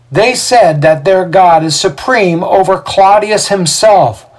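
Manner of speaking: high, loud, mounting oratory; Xander, rabble-rouser. A narrator's reading. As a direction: whip the crowd into alarm. Voices Xander inciting crowd.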